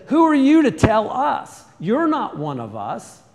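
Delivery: loud, high mocking challenge from the Hebrews; indignant shout of two quarrelling men; defiant retort. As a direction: throw it back at him, scornful. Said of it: "And then he said".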